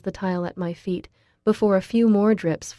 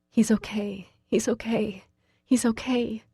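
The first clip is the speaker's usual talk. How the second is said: mantra; 4 semitones higher than usual. Acted. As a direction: chant it to calm yourself. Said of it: "I think like a mantra".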